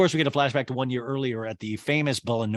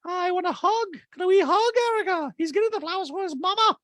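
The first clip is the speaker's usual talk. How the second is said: very high, forceful caricature; Dorit impression; 4 dB louder than usual. Dorit defending Jade to Erica, squeaky high voice. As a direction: push it up into a piercing put-on voice. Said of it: "And Dreed's like".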